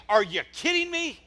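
high, loud exasperated outburst; acting out fed-up, yelled rebuke; frustrated shout. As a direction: shout it in disbelief at letting them down. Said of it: "I think he's frustrated".

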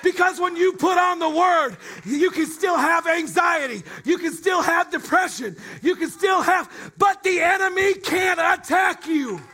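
loud, high, building preacher's cry; rising impassioned oratory. Acted. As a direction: preach it full force, climbing. Sermon builds to a shouted climax.

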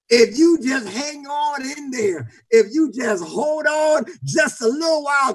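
rousing sermon build with stretched key words; full-force preaching. Real, not acted. Preacher urging the congregation not to give up, full-force preaching. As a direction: drive it home to the congregation.

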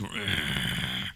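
low rolling growl; long, low, soft grr. Shown as a demonstration. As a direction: growl like an irritated animal. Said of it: "Somebody growled".

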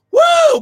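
high, loud whoop of joy; ecstatic woo. Real, not acted. Preacher whooping in praise to the church, loud woo. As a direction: whoop it out, full voice. Ecstatic cry mid sermon.

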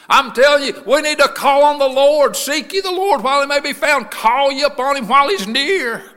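high, shouted sermon cry; full-force preaching. Real, not acted. Preaching about blind men and Jesus, full-force shout. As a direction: preach it loud and high to the whole church.

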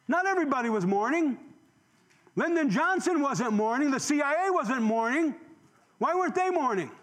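fiery preaching. Acted. Impassioned preaching on Kennedy.

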